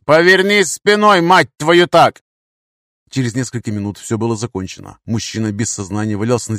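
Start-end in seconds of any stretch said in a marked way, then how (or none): 0.0-2.2 loud, high swearing command; forceful, drawn-out barked order; angry shouted order. Acted. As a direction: cut in with a furious yell. Gunman cuts off pleading.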